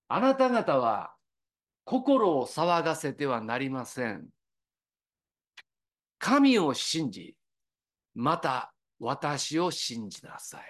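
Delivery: slow, measured reading of the verse; scripture reading. Acted. Preacher reading Jesus' words to the congregation, slow and solemn. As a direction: read the words with solemn weight, slowly.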